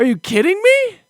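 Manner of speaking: high, loud disbelief; outraged yell. Retold or imitated. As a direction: blow up at the wife over hot dogs. Outraged at chicken pork hot dogs.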